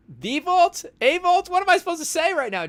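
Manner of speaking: high, loud feigned confusion; mock surprise. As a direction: pretend you never heard of it. Playing dumb about the vault.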